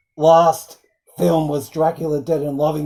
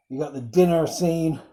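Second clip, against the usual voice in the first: normal talking voice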